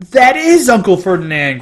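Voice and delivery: high, loud gasping realization; loud horrified gasp. A narrator's reading. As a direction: blurt it out in shock. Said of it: "Grover gasped".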